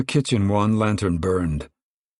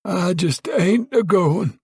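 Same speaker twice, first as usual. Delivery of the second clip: put-on high old man's voice, stubborn; stubborn Grandpa; 11 semitones higher, 3 dB louder, slower than usual. Grandpa insists to Al and Pa he's fine, high and stubborn. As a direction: crank it up high like a cranky grandpa.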